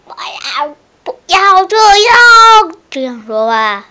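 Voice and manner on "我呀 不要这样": mock whiny voice. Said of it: "我之前记得你说话是".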